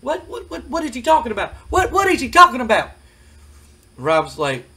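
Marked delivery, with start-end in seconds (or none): 0.0-2.9 high, fast, baffled squawk; confused Cornette. Retold or imitated. Jim Cornette in the ring, baffled by the taunt, high fast sputter. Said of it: "and is like".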